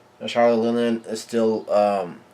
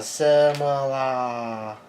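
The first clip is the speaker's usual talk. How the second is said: mock yell; 3 semitones higher, much slower than usual. Lillian crying out for her Semla, drawn-out mock yell. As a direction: stretch the last vowel in a half-hearted yell. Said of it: "And she yells out".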